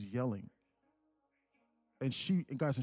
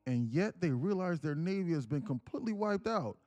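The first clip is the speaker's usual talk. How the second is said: raised, louder, chopped into bursts; choppy reading voice; 4 semitones higher, 3 dB louder than usual. Shown as a demonstration. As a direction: read it in choppy, shouted chunks. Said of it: "why is this dude reading in this choppy voice".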